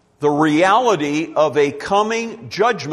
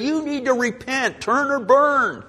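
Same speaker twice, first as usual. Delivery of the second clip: higher-pitched mock hellfire warning, slowing on the last words; in-your-face mock warning; 6 semitones higher than usual. Preacher showing how not to throw repentance in people's faces, mock in-your-face warning. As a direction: imitate a confrontational street-preacher style, pitched up. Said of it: "talking like that to people".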